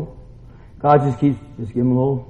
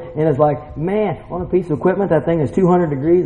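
ordinary delivery

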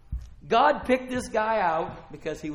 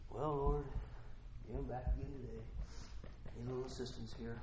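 timid prayer; 7 semitones lower, 20 dB quieter than usual. Retold or imitated. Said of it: "No, it's like".

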